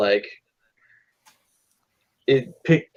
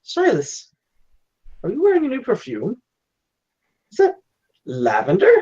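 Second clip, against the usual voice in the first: lightly acted, high questioning voice; curious fatherly probing, pitched up; suspicious father; 11 semitones higher than usual. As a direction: play a dad puzzled by a new scent. Father sniffs daughter's perfume.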